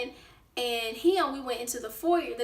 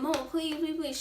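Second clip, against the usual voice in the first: begging; 4 semitones higher than usual. Retold or imitated. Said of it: "I'm sitting in there begging".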